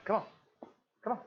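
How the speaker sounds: ordinary talk, nothing marked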